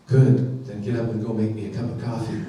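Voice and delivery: lightly acted low, quick order; bossy spouse. Spouse in bed ordering coffee, mock bossy. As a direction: drop low and rattle off the order after "Good".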